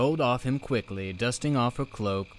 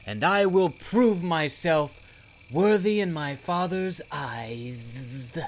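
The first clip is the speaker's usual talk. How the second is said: high, loud proud vow; heroic declaration; 7 semitones higher, 5 dB louder, slower than usual. Acted. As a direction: proclaim it like a brave hero. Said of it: "apparently fearless as he struck a heroic pose".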